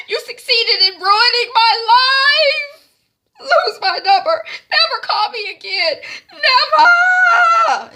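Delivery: high, loud hammed-up crying tirade; retelling a heroine's tearful phone breakup; mock sobbing rant. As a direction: wail at him down the phone. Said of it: "T Y is on the floor crying".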